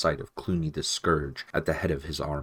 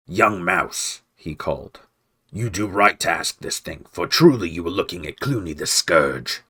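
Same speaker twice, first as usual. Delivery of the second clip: menacing villain; 6 dB louder than usual. Acted. Villain answering challenge.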